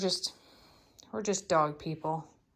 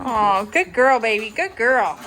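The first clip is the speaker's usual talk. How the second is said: praising dog; 10 dB louder, slower than usual. Real, not acted.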